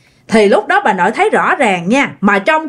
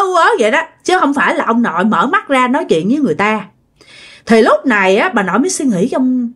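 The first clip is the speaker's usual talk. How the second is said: ordinary talk, nothing marked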